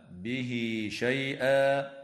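به said as bihi, the i stretched two beats into شيئا; slow chanted bihi shay'a; linked haa. Shown as a demonstration. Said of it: "وتوصل هاء الضمير".

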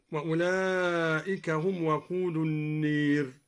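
النير said "an-neer" for an-naar; slow recitation, tilt the a of النير towards ee; imala. Shown as a demonstration. Teacher giving another example of al-Duri's imala on النير. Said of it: "وكذا أمال".

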